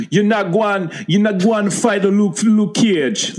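high-pitched mock Jamaican accent. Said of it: "I do a better Jamaican accent".

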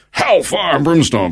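high, slow, drawn-out preacher parody; hellfire preacher. Retold or imitated. Speaker mocking the impression religion gave him, hammy hellfire preaching. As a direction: thunder it like a mock revivalist.

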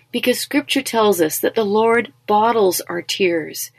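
ordinary talk

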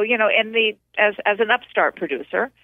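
usual speaking voice

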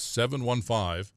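slow, deliberate phone-number dictation; nice and slow. Real, not acted. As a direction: read the digits out slowly for someone writing them down. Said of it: "I'll say it nice and slow".

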